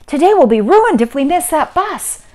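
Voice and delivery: high, loud alarmed rush; panicked. A narrator's reading. Ava and mother may miss the bus, alarmed cry. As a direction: burst out in storybook panic.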